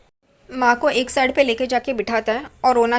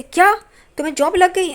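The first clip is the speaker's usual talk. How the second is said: amazed question; 6 semitones higher, 3 dB louder than usual. Retold or imitated. Sister hearing her brother got a job, surprised. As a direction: voice jumps up in disbelief. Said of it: "सब लोग हैरान हो जाते हैं".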